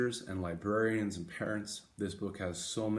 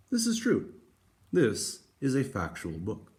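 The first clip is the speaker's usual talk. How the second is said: lively picture-book reading voice; story read aloud; 4 semitones higher, 10 dB louder than usual. Acted. Teacher reading a picture book to students, lively. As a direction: read it brightly to the class.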